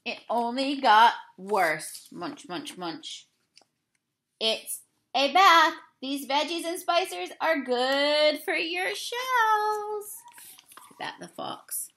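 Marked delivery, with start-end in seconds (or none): 2.1-3.3 low, soft munch munch; soft chomping noises; munching sound. Acted. Chewing noise in the story. 4.4-10.2 crafty fox luring its dinner; sly fox host. Acted. Fox coaxes turtle into soup. 10.8-11.9 hushed aside; secretive storytime whisper. Real, not acted. Aside to listeners.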